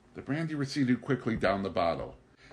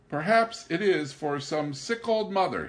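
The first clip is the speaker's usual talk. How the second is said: high, innocent wondering tone; feigned naive musing; naively; 10 semitones higher, 6 dB louder than usual. Acted. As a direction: play the innocent. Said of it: "he said naively".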